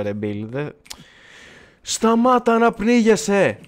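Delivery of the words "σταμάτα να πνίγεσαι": higher, louder, slowed-down cry; loud, drawn-out exasperated plea; exasperated yell. Real, not acted. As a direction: yell it at the drowning friend in exasperation. Yelling at drowning Bill.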